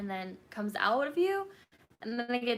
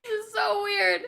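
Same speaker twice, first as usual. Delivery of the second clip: crying; 12 semitones higher, 7 dB louder than usual. Retold or imitated. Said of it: "started crying".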